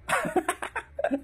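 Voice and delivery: a short unvoiced scoff of a laugh; breathy laugh. Real, not acted. Mocking the challenger's reply, a breathy scoffing laugh.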